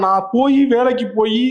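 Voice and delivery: usual speaking voice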